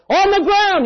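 very loud, high barked order; military police shout. Retold or imitated. Military police ordering soldiers down, loud shout. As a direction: command a crowd at gunpoint. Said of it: "they said".

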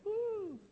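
high, soft drawn-out "ooh"; high-pitched appreciative whoop, kept quiet; delighted ooh. Real, not acted. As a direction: coo high in relish at the verse. Exclaims at the verse.

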